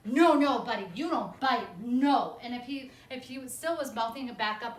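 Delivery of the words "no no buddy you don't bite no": loud, stern, emphatic scolding; loud firm correction. Shown as a demonstration. Trainer pinning the dog, scolding loud and firm. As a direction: bark the no's at the dog. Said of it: "what I would say is like".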